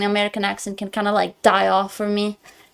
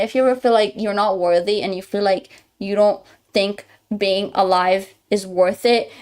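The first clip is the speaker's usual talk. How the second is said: ordinary talk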